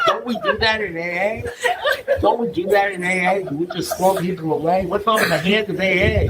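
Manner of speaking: high, insistent mock protest; imitated indignant plea. Friend appealing to AA fellowship, mock indignant pleading. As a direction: challenge them with wounded idealism, hammed-up.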